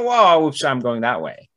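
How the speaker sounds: mock surprise. Acting out riding the economy.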